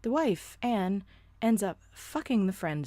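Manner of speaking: usual speaking voice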